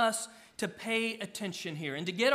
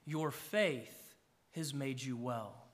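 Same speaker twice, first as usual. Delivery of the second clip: "Your faith has made you well" slow and softer, stress on your; slow solemn proclamation; 5 semitones lower, 5 dB quieter, slower than usual. Shown as a demonstration. Preacher repeating Jesus' words to close the story, slow and hushed. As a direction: let the words land softly and deliberately. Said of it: "Again, Jesus proclaims".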